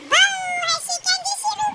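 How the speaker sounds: high, delighted wow and hi; excited greeting. Real, not acted. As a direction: greet her with wide-eyed joy. Greeting aunt on arrival.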